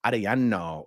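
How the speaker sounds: Arellano with tapped r, long Spanish "ah" vowels; Spanish accent. Shown as a demonstration. Streamer showing how a Latin name could sound, Spanish accent. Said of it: "a Latin name just with an American accent".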